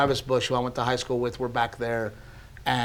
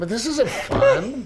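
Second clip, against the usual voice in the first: high, slow, laughing complaint; mock whining; 11 semitones higher, 3 dB louder, slower than usual. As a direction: whine it playfully, drawn out and pitched up. Imagines himself alone without his wife.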